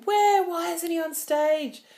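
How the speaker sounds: sudden high, loud panicked call; alarmed shout. Retold or imitated. Crew member bursting into the dressing room, high panicked shout. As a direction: burst in demanding where he is.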